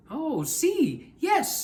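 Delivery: high, eager yes; a hint of a keen helper; eager Anthony.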